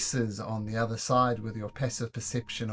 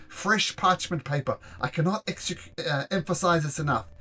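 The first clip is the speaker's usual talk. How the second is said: pedantic instructor, getting louder; fussy lecturing voice; 6 semitones higher, 9 dB louder than usual. Acted. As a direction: fuss over each detail. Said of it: "keeps on going over in excruciating detail".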